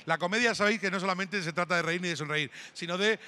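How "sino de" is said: usual speaking voice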